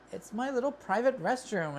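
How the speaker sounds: indignant retort. Retold or imitated. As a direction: play it as mock outrage at the line. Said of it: "And I'm like".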